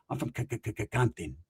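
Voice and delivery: low, soft stuttering impression; mock stutter. Tia from Compton dodging the bill, soft mock stutter. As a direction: get stuck on the first sound, quietly.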